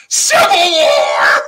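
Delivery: long, drawn-out shout; hammed-up rallying yell; mock battle cry. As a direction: bellow it slowly and very loud. Mock outburst shouted for laughs.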